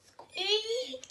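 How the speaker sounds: high thin squeak where a roar should be; a big lion that can only squeak; lion's tiny squeak. Acted. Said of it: "he let out a".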